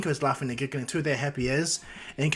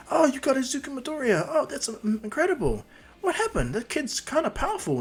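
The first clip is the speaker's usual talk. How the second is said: high-pitched impressed onlookers; high, wondering fan chatter; admiring fans; 12 semitones higher than usual. Acted. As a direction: play a curious crowd in a high voice. Crowd reacts to the hero.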